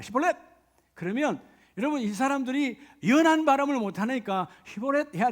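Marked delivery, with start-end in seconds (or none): 0.0-0.4 barked test word. Acted. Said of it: "홍수라는 단어를 네가 해봐라".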